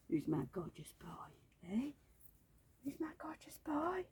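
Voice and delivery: soft unvoiced coo to a pet. Real, not acted. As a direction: whisper it fondly to the dog. Cooing to the dog in a whisper.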